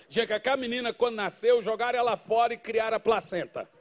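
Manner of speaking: ordinary talk, nothing marked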